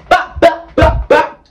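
high, loud bops; bop sound effects. Retold or imitated. Hitting the purge sisters with candy, high bops. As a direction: pop out each bop like cartoon hits.

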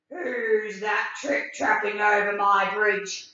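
lightly voiced grumpy troll; a hint of a gruff troll demanding; mild troll challenge at the bridge.

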